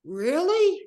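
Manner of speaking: high, loud and incredulous; Really? thrown up high in exasperation; exasperated disbelief. Retold or imitated. Retelling the doctors' news, a loud incredulous Really?. Doctors keep mom all weekend.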